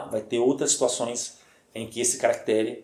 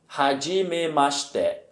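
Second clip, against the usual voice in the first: slow "hajimemashite", syllables stretched out; drawn-out teacher's "hajimemashite"; slow model repetition; slower than usual. Shown as a demonstration. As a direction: repeat "hajimemashite" slowly so learners can follow. Teacher repeats phrase slowly for learners.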